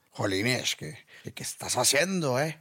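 lightly mocking Spain Spanish accent; a quick mock Castilian jab. Mocking the friend back from Madrid, light mock Spain Spanish accent. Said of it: "el de tu amigo que se fue".

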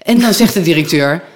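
usual speaking voice

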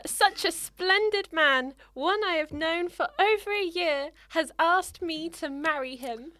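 put-on dramatic reading of an old problem letter; reading the letter aloud. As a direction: read it out like a lovesick Victorian girl. S1 reads an 1898 letter aloud.

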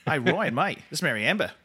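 playful put-on Australian accent; mock Australian accent, laughing at the end. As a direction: commit to a chirpy Aussie caller. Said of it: "I actually committed to the accent".

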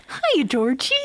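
lightly imitated creepy clown greeting; a hint of sing-song menace; Pennywise. Clown greets the boy from the drain, creepy. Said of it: "it's still very creepy voice".

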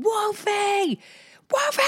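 high repeated call of "Wolfie". Retold or imitated. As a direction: yell for the boy across the street. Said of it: "the yelling".

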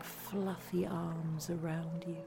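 ordinary talk

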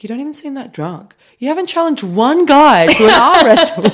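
high-pitched imitation, building to a loud laughing finish; retold teasing, rising into a squealed punchline; teasing disbelief. Retelling a friend's playful challenge.

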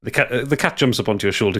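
usual speaking voice

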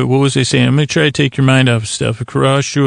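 usual speaking voice

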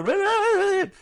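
very high, loud squealing "re re re"; ghost screeching. Pretend. Ghost screeching at a frightened passer-by, piercing high squeal. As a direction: shriek it like a spear-waving ghost. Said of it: "con la lanza ahí chillando".

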